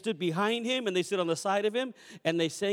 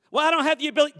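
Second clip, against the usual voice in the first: higher, louder whiny excuse; congregant's excuse, much higher, louder; doubting listener voice; 8 semitones higher, 7 dB louder, much faster than usual. Pretend. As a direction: play the complaining believer.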